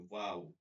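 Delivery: "ওয়াও" said with rounded lips; rounded-lip wow. Shown as a demonstration. Teacher repeating the letter waw, rounded "ওয়াও". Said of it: "ঠোঁট গোল করে".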